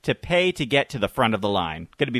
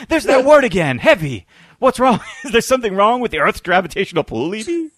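excitable scientist voice; 6 semitones higher, 4 dB louder than usual. Retold or imitated. Answering the movie quote, Doc-style.

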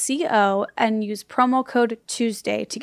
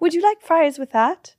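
higher-pitched mock British server; British waitress; 6 semitones higher, 3 dB louder than usual. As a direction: take an order in a put-on English accent. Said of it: "you're a waitress in this".

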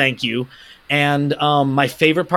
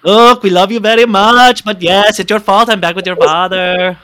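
put-on higher, louder Colombian Spanish accent; Sofia Vergara accent; 5 semitones higher, 5 dB louder than usual. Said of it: "in Sofia Vergara's accent".

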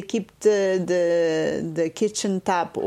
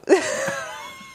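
burst of laughter. Real, not acted. Laughs at own story.

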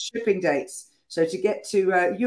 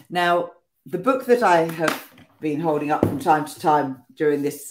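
ordinary talk, nothing marked

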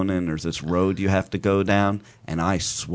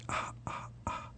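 whispered ah; 20 dB quieter than usual. Real, not acted. Shushing the others.

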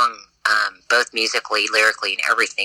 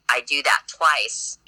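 ordinary delivery

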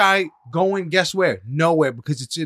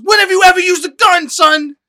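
high, loud burst of disbelief; outraged shout; 11 semitones higher, 11 dB louder than usual. Real, not acted. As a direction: shout it like you're calling out nonsense. Outraged rant at the character.

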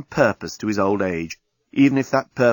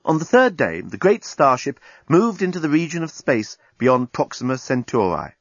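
ordinary delivery

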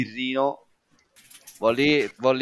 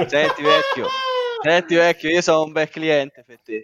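laughing; 3 semitones higher, 4 dB louder than usual. Acted. Laughing, pleading with barman.

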